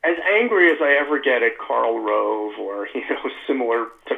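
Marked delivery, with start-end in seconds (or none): none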